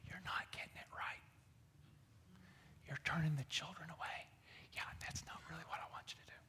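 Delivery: hushed whisper, gentle correction. Shown as a demonstration. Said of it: "But I get the idea that he said it to, like, kinda like this".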